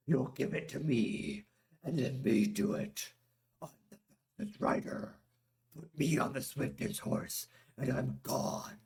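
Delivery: lightly voiced grave warrior; a hint of a stern warrior ordering; Drog voice. A narrator's reading. Drog's voice.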